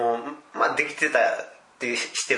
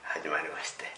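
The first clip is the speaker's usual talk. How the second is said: low, hushed, gruff opening line; deepest voice; 9 semitones lower, 13 dB quieter than usual. Shown as a demonstration. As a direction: drop to the lowest voice you have. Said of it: "自分が持ってる一番低い声で".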